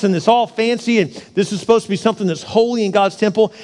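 usual speaking voice

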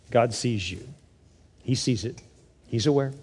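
low, hushed comfort; gentle reassurance. Real, not acted. Preacher comforting a struggling single mom, gently reassuring. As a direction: drop soft and low, letting each phrase settle.

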